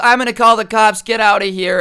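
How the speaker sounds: high, loud imitated shout; freaking-out mom. Mom ordering the man away, shouting in alarm. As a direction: yell it like a mother chasing off an intruder. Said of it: "she's freaking out".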